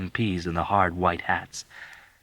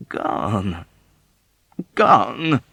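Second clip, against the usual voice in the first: crabby; 3 semitones higher, 5 dB louder than usual. Acted. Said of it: "with a crabby shrug, irritated by his persistence".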